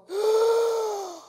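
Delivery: one long, high sucked-in breath; big sudden gasp. Retold or imitated. Patient finally able to breathe, big gasp. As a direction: gasp in a huge lungful of air. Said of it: "I could go".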